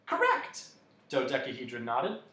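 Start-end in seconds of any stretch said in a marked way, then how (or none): 0.1-0.6 very high, pleased squeak; delighted approval. A narrator's reading. Dodecahedron approving Milo's answer, high and delighted. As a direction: confirm it way up high.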